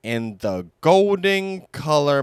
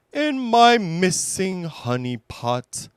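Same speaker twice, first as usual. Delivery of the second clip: Pooh voice; 7 semitones higher than usual. Acted. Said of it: "said Pooh, grinning".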